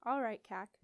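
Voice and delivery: ordinary delivery, nothing marked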